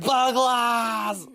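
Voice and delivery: high, loud screaming name; war cry. Acted. Bug heard by other bugs, screaming his war cry. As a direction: let it ring out like a battle cry. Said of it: "if you were a bug, you'd have heard".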